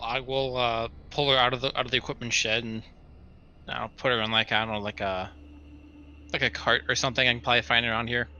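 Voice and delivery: ordinary delivery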